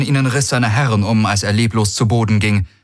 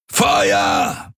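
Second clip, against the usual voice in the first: rough shouted battle order; roared command; 3 semitones lower than usual. A narrator's reading. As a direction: roar the order to shoot. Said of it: "brüllte Daraios".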